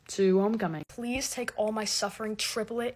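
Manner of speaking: ordinary delivery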